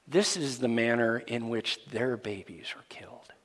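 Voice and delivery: grave whisper of a horrible fact. Real, not acted. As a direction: drop to a whisper for the awful detail. Hushed grim explanation.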